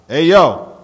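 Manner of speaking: loud, high taunting call; shouting. A narrator's reading. Gino spots the narrator across the street, shouting. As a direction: holler it out to get attention. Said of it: "Gino recognized me and started shouting".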